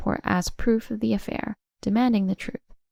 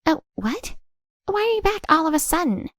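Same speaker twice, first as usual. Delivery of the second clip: high, startled woman's voice; surprised Tracy; 9 semitones higher, 3 dB louder than usual. Acted. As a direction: caught off guard, pitch shooting up. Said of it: "Surprised by her husband's sudden return".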